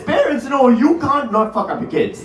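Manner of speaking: ordinary talk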